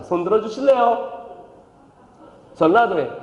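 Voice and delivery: ordinary talk, nothing marked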